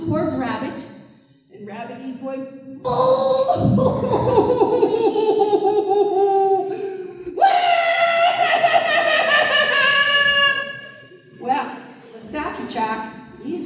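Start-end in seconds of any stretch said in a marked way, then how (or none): 2.8-10.9 hammed-up bawling; wailing baby cry. Storyteller plays the rabbit sobbing in the field, wailing like a baby. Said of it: "Rabbit crying like a baby".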